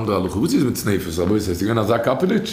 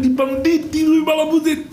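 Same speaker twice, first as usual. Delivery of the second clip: very high-pitched imitated outcry; mock shouting. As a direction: shriek it in mimicry, more pitch than volume. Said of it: "Und der Chachiga schreit".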